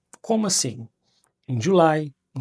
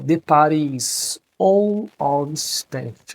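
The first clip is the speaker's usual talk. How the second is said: slow model sentence; 5 dB louder, much slower than usual. Shown as a demonstration. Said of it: "ó".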